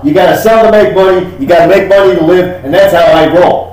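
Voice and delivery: high, loud, insistent sales pitch; hustling gun dealer. Acted. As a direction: preach the hustle to the buyer. Dealer's sales philosophy, louder.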